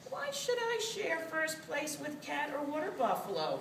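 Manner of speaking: high little rat voice; scheming rat. A narrator's reading. Rat plotting to keep first place, high scheming voice.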